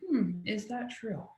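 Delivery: soft, slow, thoughtful murmur to herself; inner wondering. Retold or imitated. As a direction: muse it quietly, slowly. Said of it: "stopping in my tracks and thinking".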